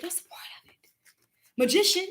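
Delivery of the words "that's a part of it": unvoiced whispered aside. Real, not acted. Telling viewers the secret aside in a whisper.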